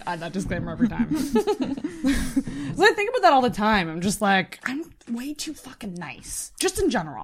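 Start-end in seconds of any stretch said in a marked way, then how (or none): 4.6-6.5 hushed confession; whisper. Real, not acted. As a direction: whisper it like a secret. Confessional aside.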